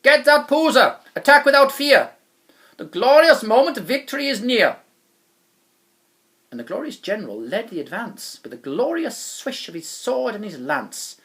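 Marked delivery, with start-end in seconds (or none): 0.0-4.8 loud, high battle cry; rally the troops to charge; yell. A narrator's reading. General urging his troops to attack, yelling. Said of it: "And then he yelled".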